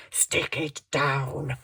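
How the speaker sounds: "Stick it down" slowly stretched out; drawn-out repeat. Real, not acted. Crafter to herself while gluing, "Stick it down" drawn out. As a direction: draw out each word of "Stick it down".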